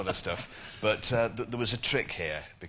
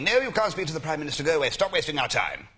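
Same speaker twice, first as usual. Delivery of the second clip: switchboard brush-off; 6 semitones higher, 7 dB louder than usual. Retold or imitated. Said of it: "the person on the switchboard saying".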